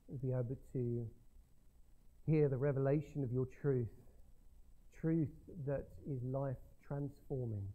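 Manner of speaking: soft, low prayer voice. Acted. Pastor praying over the congregation, soft and low. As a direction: pray quietly and humbly.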